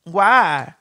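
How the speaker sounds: ordinary delivery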